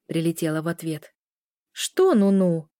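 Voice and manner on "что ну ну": louder, indignant comeback. A narrator's reading. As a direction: fire the question back in protest. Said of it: "возмутилась девушка".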